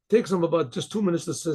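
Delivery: usual speaking voice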